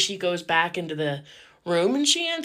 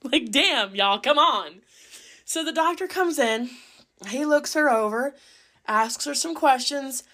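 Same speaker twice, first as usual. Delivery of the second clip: ordinary talk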